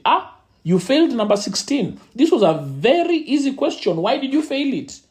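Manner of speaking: a hint of a stern, questioning father; lightly voiced dad picking at one wrong answer. Father questioning his son over a failed question, lightly stern.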